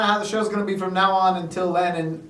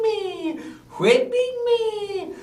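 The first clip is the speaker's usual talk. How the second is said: high, drawn-out falsetto wail; hammed-up high wail, drawing out each word; mock whipping wail; slower than usual. Servant whipped by his master, mock wailing.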